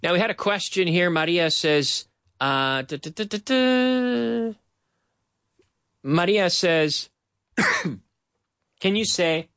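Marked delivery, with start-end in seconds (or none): 3.0-4.5 slow duh duh rhythm, pitched up; rhythm syllables. Shown as a demonstration. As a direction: tap out the beat slowly. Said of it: "rhythm demo of syllables".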